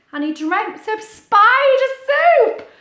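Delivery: high, loud, slow spooky build; hammed-up dramatic storyteller; dramatic reading. A narrator's reading. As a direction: make it sound like a nightmare.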